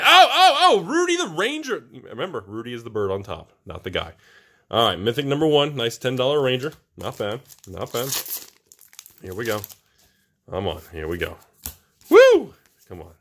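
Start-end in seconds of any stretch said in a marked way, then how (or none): 0.0-1.8 loud, high whoops of delight; excited outburst. Real, not acted. Card opener spotting a mythic, loud excited cry. Sudden good card appears. 12.1-12.5 short high-pitched woo; loud whoop. Real, not acted. Card opener psyching up for the next pack, loud whoop.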